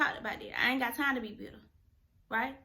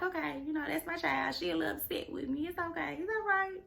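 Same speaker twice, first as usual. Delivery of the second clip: gentle God voice; 3 semitones higher, 7 dB quieter than usual. Retold or imitated. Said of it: "God be like".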